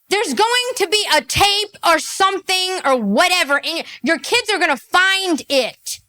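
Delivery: rising finger-wagging lecture; heated warning. Real, not acted. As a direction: warn her forcefully.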